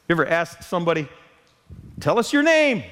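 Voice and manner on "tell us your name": chirpy greeter. Retold or imitated. Greeter voice again.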